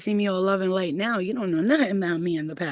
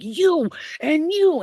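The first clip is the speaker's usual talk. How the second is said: much higher, louder, slow; mock fight call; 10 semitones higher, 5 dB louder, much slower than usual. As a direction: point them out, menacing. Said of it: "I was like".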